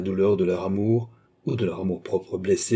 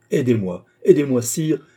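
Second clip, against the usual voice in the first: louder, rising appeal for help; urgent plea; 4 semitones higher, 6 dB louder than usual. A narrator's reading. Queen begging the king against slander, urgently. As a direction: beg urgently, building on the repeat.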